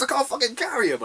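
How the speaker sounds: quoting his mate's excuse in a squeaky voice; mimicking mate. Mate Katsu explaining the fingers in the glass, squeaky mock voice.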